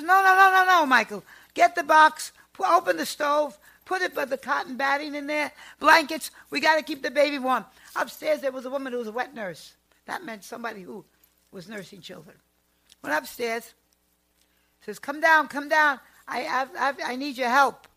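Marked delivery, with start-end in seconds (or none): 0.0-7.6 loud, high urgent orders; wife urgently ordering her husband about; urgent shouting. Retold or imitated. Grandmother urgently instructing husband. 15.0-17.9 loud, high urgent call for help; calling the upstairs neighbour for help. Retold or imitated. Calling upstairs to wet nurse.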